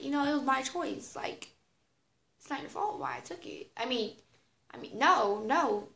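ordinary talk, nothing marked

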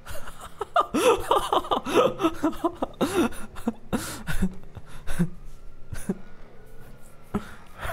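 breathless laugh. Real, not acted. Laughing at himself.

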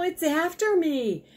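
high-pitched frightened squirrel voice; panicked squirrel. Acted. Squirrel fleeing into the coop, panicked. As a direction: pitch it way up in alarm. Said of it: "He was out of breath and in a panic".

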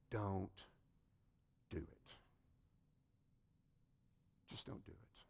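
whispered, very soft; hushed plea. Acted. The preacher whispers Jesus' warning to the congregation. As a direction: breathe the words gently.

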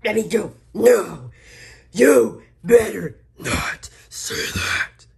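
loud, high angry yell, last line slow; furious outburst. Acted. Angry at the sexist brother, a loud furious yell. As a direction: snap at him to take it back.

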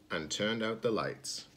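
ordinary delivery, nothing marked

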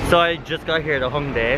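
ordinary talk

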